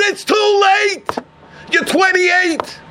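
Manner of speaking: high, drawn-out insistence; exasperated refusal. Acted. Father tells his son it is too late, high exasperated insistence. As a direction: shut the plea down in a high, stretched voice.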